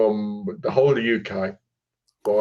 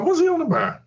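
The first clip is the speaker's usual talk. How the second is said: very high mock confusion; confused self. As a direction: squeak it out baffled. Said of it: "I was like".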